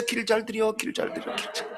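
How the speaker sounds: murmur to whisper. Retold or imitated. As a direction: keep repeating it under your breath as you walk down the aisle. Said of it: "팔짱 끼고 나가면서".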